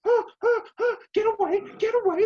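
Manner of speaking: hammed-up terrified squeal to get away; panicked Bills. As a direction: squeal like you're being chased. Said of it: "the Bills are like".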